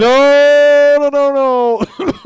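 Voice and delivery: loud, high string of refusals; alarmed shout. Real, not acted. Host cutting off caller's dark remark, loud high protest.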